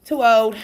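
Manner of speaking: put-on insist, Too old; emphatic too old. Shown as a demonstration. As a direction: punch the word old. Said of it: "I'm too old to care".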